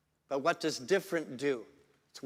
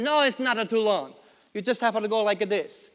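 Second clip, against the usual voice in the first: higher-pitched tailor with a put-on accent; joke tailor; 5 semitones higher, 5 dB louder than usual. Tailor waving away a customer's complaint, higher-pitched comic protest. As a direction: play the tailor brushing off a complaint.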